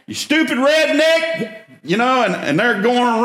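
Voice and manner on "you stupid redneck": much higher, slow sneering driver voice; mocking insult. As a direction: jeer at him like an angry passing driver. Honking drivers insulting him.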